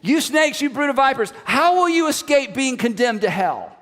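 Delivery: rising, loud condemnation of the vipers; Jesus rant, full. Retold or imitated. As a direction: denounce the leaders from the pulpit. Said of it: "it is a rant".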